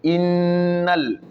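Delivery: Innal with the n held for two beats, chanted; Innal, shadda on the n drawn out; doubled n. Shown as a demonstration. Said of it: "on tire deux".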